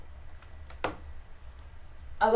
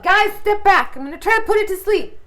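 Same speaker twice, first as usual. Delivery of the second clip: high, loud call to the group; yelled warning; 7 semitones higher, 7 dB louder than usual. Acted. Player warns the party before casting sleep, yelled. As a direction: yell out a warning to your companions. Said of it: "I'm just gonna yell out".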